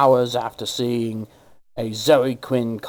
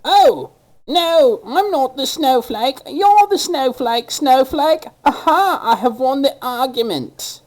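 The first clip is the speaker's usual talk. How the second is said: much higher, loud, whiny mimicry; mocking snowflake; 10 semitones higher, 3 dB louder than usual. Mocking special snowflakes in an internet argument. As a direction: sneer it like a smug child. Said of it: "and go".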